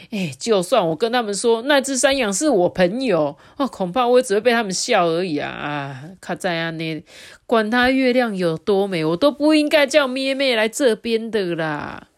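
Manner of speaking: lightly voiced anxious wolf; regretful wolf. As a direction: worry aloud about the goat. Kazi regretting.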